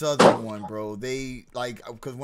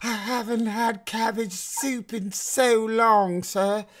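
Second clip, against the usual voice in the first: high, pleading British peasant voice begging for soup; hammed-up British peasant accent; 6 semitones higher, 8 dB louder, slower than usual. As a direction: beg like a poor Cockney orphan, in British accent. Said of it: "I put on this fucking peasant British accent".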